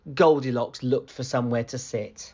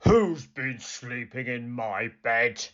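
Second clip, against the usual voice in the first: gruff Father Bear growl; big gruff bear demanding an answer; great gruff voice. Acted. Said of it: "growled Father Bear in his great gruff voice".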